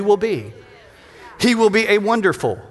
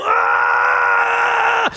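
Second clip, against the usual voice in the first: high straining cry under a heavy bar; mock lifting strain; straining yell; 14 semitones higher than usual. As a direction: yell like the weight is crushing you. Straining under a heavy bench press.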